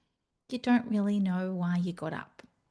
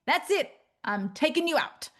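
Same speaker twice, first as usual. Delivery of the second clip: fed-up boulder; 8 semitones higher than usual. Retold or imitated. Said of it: "a giant boulder flattens you and goes".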